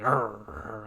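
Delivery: low imitated growling; dog growl. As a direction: play the dog, half-heartedly growling. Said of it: "Her dog growls at Chapman".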